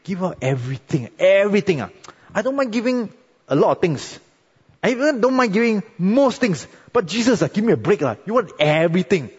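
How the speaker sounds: high, pleading protest; mock complaining believer. Believer protesting to Jesus, mock complaining. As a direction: whine like someone asked to give too much. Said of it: "some of you would think".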